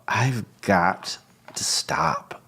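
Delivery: slow, breathless whisper to himself; whispered thought. Retold or imitated. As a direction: whisper it slowly as a private realisation. Inner thought, sudden realisation.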